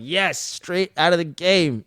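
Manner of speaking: retold elation. Fan cheering a line from the game, elated. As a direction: stretch it out in fan delight. Re-enacting own delight at the line.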